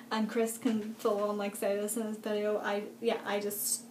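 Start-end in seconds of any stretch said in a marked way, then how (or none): none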